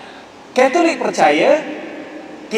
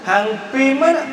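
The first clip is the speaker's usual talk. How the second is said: higher Kedah Malay question, mana stressed; Kedah dialect; 6 semitones higher, slower than usual. Shown as a demonstration. Lecturer contrasting Kedah with standard Malay, Kedah accent. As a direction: answer it the Kedah way. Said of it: "Orang Kedah cakap macam mana?".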